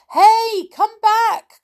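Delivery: very high, loud shout; urgent calling out. A narrator's reading. Moonface calls after the duck carrying Joy off, urgent shout. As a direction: call after someone flying away. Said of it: "cried Moonface".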